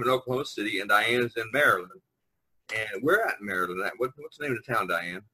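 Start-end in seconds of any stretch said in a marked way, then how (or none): none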